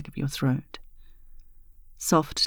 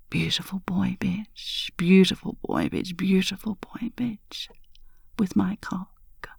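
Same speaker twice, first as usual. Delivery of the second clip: repeated hushed whisper, rising higher each time; obsessive whisper; 5 semitones higher, 3 dB quieter than usual. A narrator's reading. Woman obsessively whispering pet names to her lover, hushed. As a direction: murmur it over and over like a fixation. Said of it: "I whisper obsessively".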